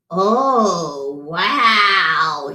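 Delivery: a gasp of shock. A narrator's reading. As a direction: gasp at what you see. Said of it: "he gasped".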